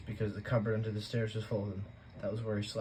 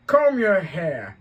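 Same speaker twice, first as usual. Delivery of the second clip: much louder, slow gruff bark; a bark for a greeting; Uncle Vernon barking; 8 semitones higher, 14 dB louder, much slower than usual. A narrator's reading. Uncle greeting the boy with a loud bark. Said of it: "he barked".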